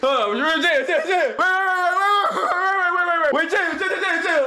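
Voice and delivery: frantic protest. Real, not acted. Streamer stopping people going for clips, frantic repeated protest. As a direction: laughingly beg them to stop, words tumbling out. Trying to halt laughing listeners.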